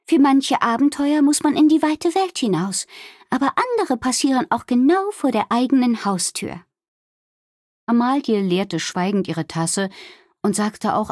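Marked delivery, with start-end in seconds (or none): 0.0-6.6 high-pitched storybook innkeeper, musing; reflective innkeeper Wilma. Acted. Wilma philosophising to friends over tea, thoughtful.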